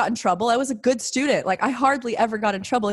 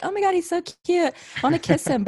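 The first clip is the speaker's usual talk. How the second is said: put-on higher, swooning teen voice; gushing schoolgirl crush; 8 semitones higher than usual. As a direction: play her younger self swooning. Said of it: "I'd be like".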